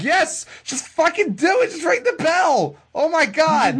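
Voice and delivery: high-pitched exasperated mock yell; imitated impatient yelling. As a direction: yell it high, without raising real volume. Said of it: "she just keeps, like, yelling out".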